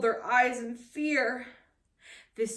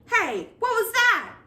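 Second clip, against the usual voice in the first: a much higher, louder yell in another voice; 12 semitones higher, 8 dB louder than usual. Acted. Pirate hearing a splash, yelling. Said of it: "yelled Morgan O'Meany".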